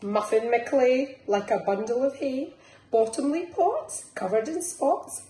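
lilting picture-book reading; reading to children. Acted. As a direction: bounce the rhymes along for little ones. Reads picture book aloud.

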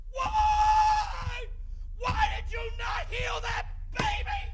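very high, slow, strained shouting at God; anguished shouting. Retold or imitated. Preacher screaming at God over the dead baby, high slow anguished shouting. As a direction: scream the question at heaven, slowing in anguish. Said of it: "and I was yelling".

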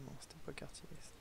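hushed unvoiced whisper while sneaking. Real, not acted. Sneaking past guards in a forbidden zone, whispering. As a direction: whisper so the guards won't hear.

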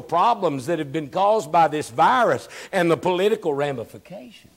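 high, loud, rhythmic preaching; preaching at full force. Acted. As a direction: stir them up, dearly beloved.

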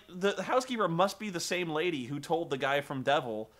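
usual speaking voice

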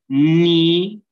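Ni held long, loud and high; sílaba aislada. Shown as a demonstration. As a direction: sound out Ni as one separate syllable. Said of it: "cómo tengo que silabear? Simplemente así". (Ni fuerte, alta y alargada)

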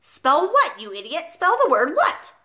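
high, insistent headmistress demand; impatient, emphatic order; exasperated scolding. A narrator's reading. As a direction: berate the pupil, pitch leaping on the demands. Headmistress berates the boy.

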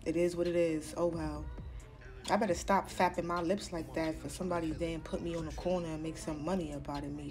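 ordinary talk

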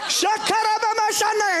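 high laughing speaking in tongues. Retold or imitated. Driver praying in tongues, laughing.

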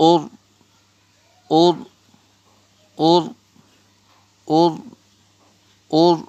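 "or" sounded firmly on its own; "or" repeated singly, with pauses; drilled "or". Shown as a demonstration. Teacher shows alif pesh ra jazm, drilling "or". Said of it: "উচ্চারণ".

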